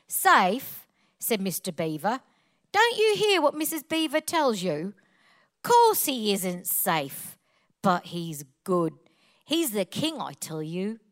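punchy Cockney reading, slowing on key words; Cockney accent. Shown as a demonstration. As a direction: read it out in your best Cockney. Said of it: "Please read it with a Cockney accent".

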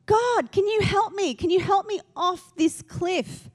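high-pitched mock plea; acted pleading. Dangling man pleads with God for rescue, high plea.

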